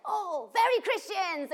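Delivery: high, loud, slow delighted cry; delighted exclaim. Retold or imitated. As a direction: draw it out in delight. Refugees' delighted answer.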